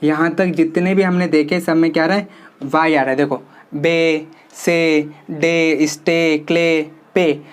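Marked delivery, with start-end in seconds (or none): 3.2-7.4 slow, drawn-out rehearsal of बे, से, डे, स्टे, क्ले, पे with long ए; stretched rhyming words. Shown as a demonstration. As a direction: stretch each rhyme word: बे, से, डे, स्टे, क्ले, पे. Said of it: "बोलने में है ना rhyming word है". (लंबी ए के साथ धीरे-धीरे बोले गए तुकबंदी शब्द)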